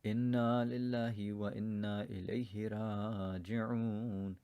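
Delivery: quiet Quranic recitation; solemn recitation. Acted. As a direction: recite the verses low and reverent. Recites Arabic verses.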